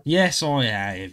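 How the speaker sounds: put-on drawn-out Welsh-accented reply; Welsh accent, attempted. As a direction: stretch it slowly in a Welsh accent. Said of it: "That's a Welsh accent".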